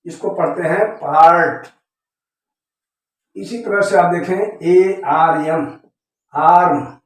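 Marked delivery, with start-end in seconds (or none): none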